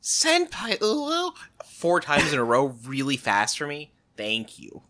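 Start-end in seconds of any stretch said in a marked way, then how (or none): 0.0-1.3 anime voice. Pretend. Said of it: "can you just go".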